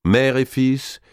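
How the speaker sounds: ordinary delivery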